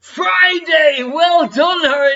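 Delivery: loud, high cheer; excited cheer. Real, not acted. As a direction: cheer the right answer. Correct guess cheered.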